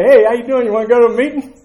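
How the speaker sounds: cheerful sponsor. Retold or imitated. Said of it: "He would call me and say".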